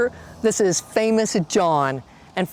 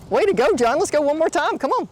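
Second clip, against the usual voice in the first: excited cheering; 7 semitones higher, faster than usual. Real, not acted. Cheering the rider on.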